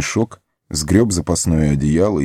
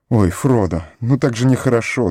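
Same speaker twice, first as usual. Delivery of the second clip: tearful voice; 5 semitones higher than usual. Acted. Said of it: "дрожа плачущим голосом".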